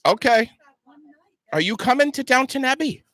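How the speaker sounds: cheery host greeting. Real, not acted. Greeting passersby on air.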